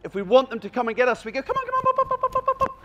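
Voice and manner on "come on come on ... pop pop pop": much higher, excited calling; squeaky encouraging call to bring the dog in; enthusiastic. Shown as a demonstration. Trainer shows calling a dog in, very high and enthusiastic. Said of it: "we're enthusiastic".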